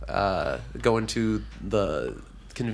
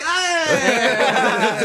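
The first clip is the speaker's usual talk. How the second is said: high, louder laughing cry; mock happy yelp; 12 semitones higher, 5 dB louder than usual. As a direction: yelp with delight at the pizza. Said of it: "He's like".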